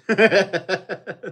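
hearty laugh; loud laugh. Real, not acted. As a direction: burst out laughing. Laughs after joke about millions.

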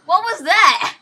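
loud, high startled outburst; surprised shout. Real, not acted. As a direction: yell in shock at the screen. Startled by the game moving fast.

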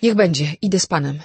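loud, frightened cry after him; desperate call. A narrator's reading. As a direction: shout after him, not wanting to be left alone. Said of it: "Vera zawołała desperacko".

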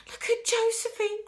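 high-pitched alarmed exclamation; crying out. A narrator's reading. Pointing Mister Wonka to Josephine, crying out. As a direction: cry out in shocked dismay. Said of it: "cried Grandma Joe".

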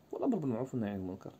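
ordinary talk, nothing marked

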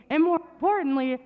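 normal talking voice

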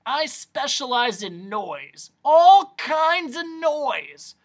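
slow, high, grandiose boast; eccentric noise doctor. Acted.

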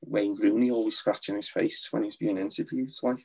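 Liverpool accent, at an easy conversational level; Scouse accent. Shown as a demonstration. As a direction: talk like a Scouse footballer being interviewed. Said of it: "a Scouse accent".

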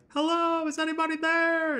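very high, loud calling out into the void; small far-off call. Pretend. Calling out to distant camera.

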